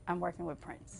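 breathless whisper of disbelief; hushed, stunned whisper; awed whisper. Retold or imitated. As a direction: whisper it like you can't believe it. Awed realisation.

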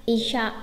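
"Isha" with a hushing sh; correct "Isha" pronunciation. Shown as a demonstration. Teacher repeating the right form, "Isha" with clear sh. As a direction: say "Isha" with sh, not s. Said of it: "mà là isha".

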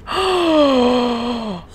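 long shocked gasp; drawn-out gasp of disbelief. Real, not acted. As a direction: draw in a long, startled breath. Shocked at the profile.

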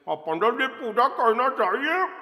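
high, loud voice through a mouthful of pan; mouth full of pan. Retold or imitated. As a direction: talk through chewed pan like the men in movies. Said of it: "And they'll be like".

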